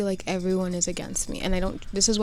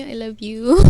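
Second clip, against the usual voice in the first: loud shout-out; 2 semitones higher, 10 dB louder than usual. Real, not acted. Shout-out to mom watching.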